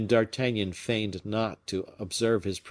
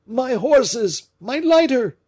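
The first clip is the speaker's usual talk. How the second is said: very high, loud frantic shout; 8 dB louder, slower than usual. A narrator's reading. Fouquet shouting for his servants in panic, frantic shout. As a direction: yell for the horses in panic. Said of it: "hung to the bell rope and shouted".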